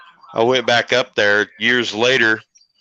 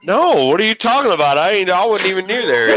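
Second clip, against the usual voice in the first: mock innocent denial; 8 semitones higher than usual. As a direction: deny it all like a guilty teenager, pitch way up. Son denying to dad.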